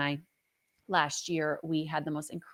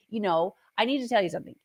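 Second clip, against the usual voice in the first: usual speaking voice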